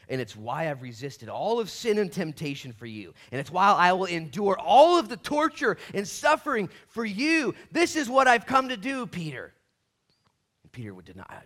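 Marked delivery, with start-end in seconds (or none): 0.1-9.5 preacher voicing Jesus at full pitch; impassioned declaration. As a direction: build from firm to a high, loud cry. Jesus' speech builds to passionate climax.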